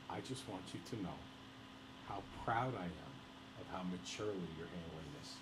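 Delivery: soft, low, earnest mother voice; tender mother. Retold or imitated. Mother reaches across the table to her son, quiet and tender. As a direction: imitate a mother's quiet, sincere praise.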